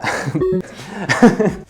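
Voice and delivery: short amused laugh after tripping over the words; real laugh at own slip. Real, not acted. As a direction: laugh off the fumble.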